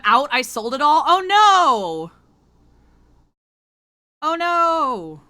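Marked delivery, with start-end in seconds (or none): 1.1-2.1 loud, high cry of dismay; real shocked dismay, thrown out high; mock-tragic loud oh no. Real, not acted. Streamer reacts to sold-out ice cream. 4.2-5.2 dismayed oh no. Real, not acted. Repeats dismay after pause.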